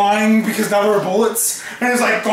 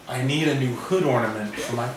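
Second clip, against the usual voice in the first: lower, softer, matter-of-fact aside; normal voice; 7 semitones lower, 8 dB quieter than usual. Acted. Victor speaking normally beside Godzilla, quiet low voice. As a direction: drop the Viking bluster, speak low and casual. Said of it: "He said that in a normal voice".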